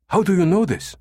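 high, insistent question; agitated demand. A narrator's reading. Numataka demanding the American's source, agitated. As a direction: demand to know, pitched high.

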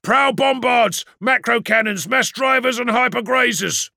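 loud, high shouted list; urgent threat callout. Acted. Calling out incoming weapons.

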